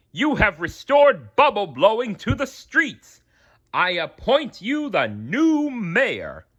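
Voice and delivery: high, slow pompous official; grandly thanking the hero; mayor voice. A narrator's reading. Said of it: "the mayor said".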